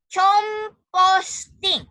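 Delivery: composting broken into chom-pos-ting, very slow; spelling pronunciation. Shown as a demonstration. Teacher shows pupils how composting is written, spelling pronunciation chom-pos-ting. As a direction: say composting as written, ch at the start, each syllable stretched.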